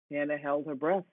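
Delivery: usual speaking voice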